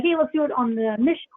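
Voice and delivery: ordinary talk, nothing marked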